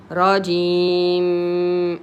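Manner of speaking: very slow رجيم, long ee and hummed m; prolonged ya. Shown as a demonstration. Teacher models prolonging two periods, holding the ee in رجيم. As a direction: stretch the ee of رجيم, level to the end. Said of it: "Prolong two periods".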